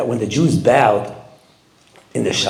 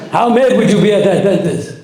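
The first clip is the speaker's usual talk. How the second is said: laughing; 5 semitones higher than usual. Real, not acted. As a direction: laugh as you ask it. Laughing at own joke.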